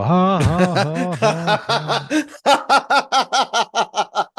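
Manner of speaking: high, loud mock audience laughter; canned sitcom crowd laughing in chorus; hammed-up crowd laughing at the contestant; studio audience laughing. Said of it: "they just start laughing".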